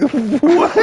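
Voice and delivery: high, laughing what; mock baffled drunk, laughing high; laughing disbelief. Retelling being yelled at.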